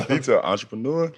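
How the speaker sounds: entrepreneur with a rising, questioning tune; mock the word entrepreneur as if unsure. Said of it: "He said as a question".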